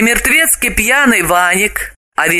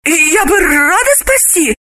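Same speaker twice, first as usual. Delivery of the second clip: put-on much higher young woman's voice, sympathetic; sweetly sympathize in a high girlish voice; high woman's voice, full of pity; sympathetic Galina; 10 semitones higher than usual. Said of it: "посочувствовала она".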